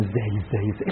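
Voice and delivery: quiet dwindling echo of the question; fading murmur. Acted. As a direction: let the repeated word die to almost nothing. Preacher repeats how, then fades.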